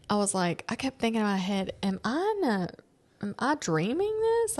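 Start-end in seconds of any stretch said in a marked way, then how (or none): 1.8-4.6 high-pitched mock bewilderment; retold disbelief. Customer thinking to herself about the earring price, bewildered. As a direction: voice climbing as if doubting it's real.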